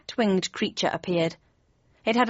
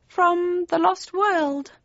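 very high, very slow cat voice; querying cat; 10 semitones higher, much slower than usual. A narrator's reading. Cheshire Cat querying Kane's dinosaur, high and slow. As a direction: ask it high, stretching each word.

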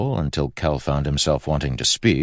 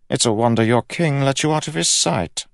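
very high royal voice; needling king; 12 semitones higher, 4 dB louder than usual. A narrator's reading. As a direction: speak as a haughty monarch, pitched way up.

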